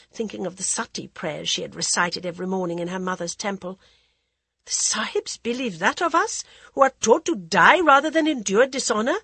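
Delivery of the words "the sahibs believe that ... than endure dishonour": put-on higher, anxious young bride; timid and pleading young woman; young wife voice. Jaya asks.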